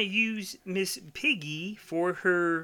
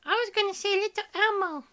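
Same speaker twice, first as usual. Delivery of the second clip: Elmo voice; 5 dB louder, faster than usual. Pretend. Joking about doing Elmo.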